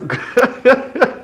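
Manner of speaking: high chuckle cutting off the sentence; amused laugh. Real, not acted. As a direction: burst into real laughter mid-thought. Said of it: "you know what I'm laughing about".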